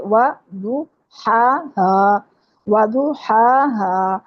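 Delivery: وضحاہا drawn out very slowly, with a clear ض and no doubled د; correct pronunciation. Shown as a demonstration. Teacher correcting a student's misreading of وضحاہا, very slowly. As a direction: stretch وضحاہا slowly with a full ض sound. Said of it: "ودوہا نہیں ہے یہ".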